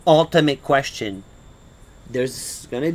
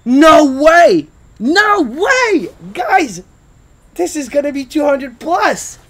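excited shouting; 12 semitones higher, 8 dB louder, much slower than usual. Real, not acted. Still celebrating the win.

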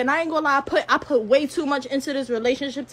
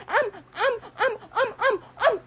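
high, halting stammer on one word; so worked up the words stick; stuttering mad; 7 semitones higher, 5 dB quieter than usual. Real, not acted. Ranting at her rival on live, real angry stutter. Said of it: "I'm stuttering right now".

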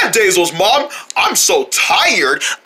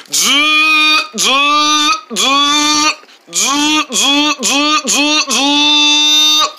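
snoring; 4 semitones higher, much slower than usual. Acted. Sleeping character snoring.

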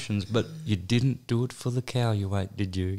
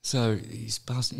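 ordinary talk, nothing marked

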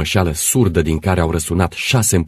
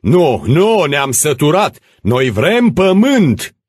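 high, loud angry refusal; deafening shouts; 12 semitones higher, 7 dB louder, much slower than usual. Acted. Crowd interrupting the administrator, deafening shouting. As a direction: cut him off with a yell. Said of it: "Strigăte asurzitoare îi tăiară vorba".

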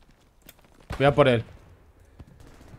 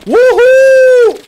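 high, loud triumphant whoop; victory whoop; 11 dB louder than usual. Real, not acted. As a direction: celebrate the kill with a yell. Celebrating a kill. (grito de victoria eufórico)

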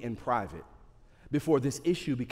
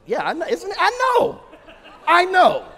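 laughing disbelief; 10 dB louder than usual. Real, not acted. Laughing reaction to the insult.

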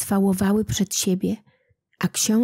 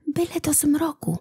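whisper; 8 semitones higher than usual. A narrator's reading. Bartosz hides from soldiers, whispered hope. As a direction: hush it, hoping for dark. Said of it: "powtórzył Bartosz".

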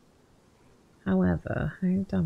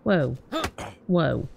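usual speaking voice

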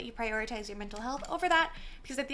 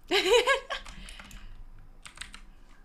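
airy high laugh, no voice behind it; breathless laugh; 8 semitones higher than usual. Real, not acted. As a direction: let out a breathy giggle. Laughs at chat.

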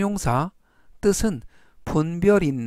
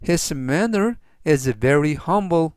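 slow model sentence; much slower than usual. Shown as a demonstration. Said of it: "전체적 의미는".

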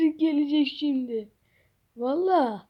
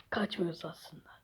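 lower, soft unvoiced whisper; whispered aside; 8 semitones lower, 16 dB quieter than usual. Hiding kid whispering an aside to the audience. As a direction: whisper it under your breath.

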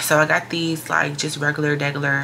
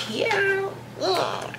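excited squeal; 5 dB quieter than usual. Real, not acted.

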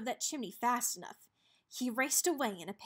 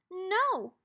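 higher, louder pigs' no; cry out; 8 semitones higher, 6 dB louder than usual. A narrator's reading. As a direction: shout it back, higher. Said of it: "cried the pigs".

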